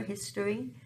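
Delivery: ordinary delivery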